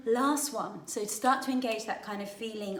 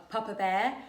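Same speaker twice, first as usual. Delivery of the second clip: normal talking voice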